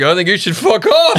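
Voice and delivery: a high cheeky comeback said through a laugh; laughing retort. Retold or imitated. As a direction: laugh while telling her off. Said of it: "I'm like".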